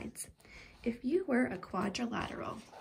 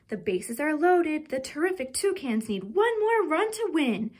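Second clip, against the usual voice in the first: louder, higher, like a ballgame announcer; eager, raised storytelling voice; excited; 6 semitones higher, 6 dB louder than usual. A narrator's reading. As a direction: build the thrill of a close game. Exciting game moment in story.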